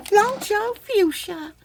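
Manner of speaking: high, slow, sassy sing-song; sassy snap delivery. Pretend. Showing off a bead name to viewers with sass. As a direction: toss out the name with snapping attitude. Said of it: "I had to do some snaps".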